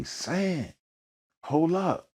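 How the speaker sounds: high call across the lot. Retold or imitated. Miles calling out to Sam from his car, high. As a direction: call him back, high.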